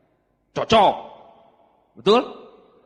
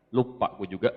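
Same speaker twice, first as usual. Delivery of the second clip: sheepish reply; 11 semitones lower, 7 dB quieter than usual. Retold or imitated. Young man to his brother, admitting it sheepishly and quietly. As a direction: lower and softer, caught out.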